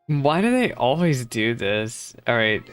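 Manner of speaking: normal talking voice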